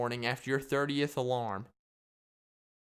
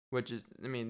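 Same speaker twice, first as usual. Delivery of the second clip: normal talking voice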